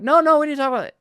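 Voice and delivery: hammed-up pushy husband; husband pressing his wife to talk; insistent mock protest. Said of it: "I'm like".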